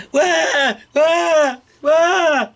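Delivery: put-on high, loud baby "wah". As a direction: wail like an upset baby. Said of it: "the baby cried even louder".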